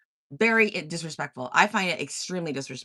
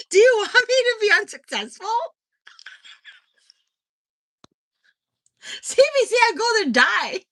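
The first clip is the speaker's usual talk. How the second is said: loud, high-pitched, laughing squeal; cracking up, voice pitched way up; laughing disbelief; 8 dB louder than usual. Real, not acted. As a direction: squeal it through laughter, incredulous. Laughing at joke about CBC.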